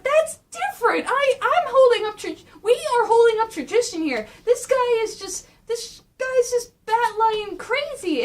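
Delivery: high, loud, indignant rant; heated protest. Acted. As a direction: defend yourself, getting worked up. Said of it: "He's going like".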